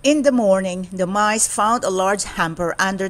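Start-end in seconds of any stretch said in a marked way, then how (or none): none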